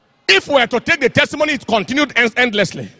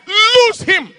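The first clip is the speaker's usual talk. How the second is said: commanding shout; 9 semitones higher than usual. Retold or imitated. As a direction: cast it out with force. Repeated command to release.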